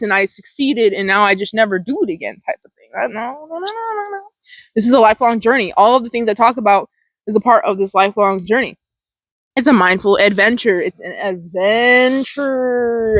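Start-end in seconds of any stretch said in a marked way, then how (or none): 3.1-4.2 soft noes sliding way up high; quiet, rising singsong no-no-no; rising playful noes. Pretend. 10.8-13.2 "adventure" held long and playful; slow, lingering singsong on "adventure"; drawn-out ADVENTURE. Pretend.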